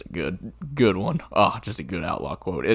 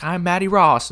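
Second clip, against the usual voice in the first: put-on high, loud girl calling out; Mattie's call; 11 semitones higher, 7 dB louder than usual. Mattie calling to Rooster in the outhouse, high and loud. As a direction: insist through the outhouse door. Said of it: "she's like".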